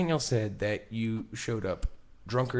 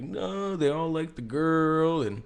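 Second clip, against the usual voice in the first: higher, slow, overly innocent voice; mock innocent dodge; 7 semitones higher, 5 dB louder than usual. Friend mimics Corey evading the Saturday story, higher and sing-song innocent. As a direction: play it sweet and evasive, stretching each bit.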